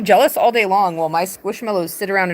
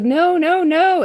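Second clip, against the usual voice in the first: mock panicked denial; 10 semitones higher, 4 dB louder, slower than usual. Judas denying to the Romans.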